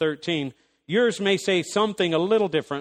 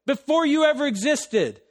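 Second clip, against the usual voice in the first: much higher, louder pulpit voice, words hammered; full-force preaching; 9 semitones higher, 5 dB louder than usual. Acted. Preacher pressing the point on the congregation, fervent preaching. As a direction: preach it with fire, pounding each phrase.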